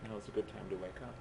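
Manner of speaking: a soft, quick nudge to wake up, voice failing; can't push it out, so say it softly and fast; quiet wake-up call. Real, not acted. Lecturer losing his voice urges sleepy students, softly. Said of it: "I can't actually say that particularly loudly".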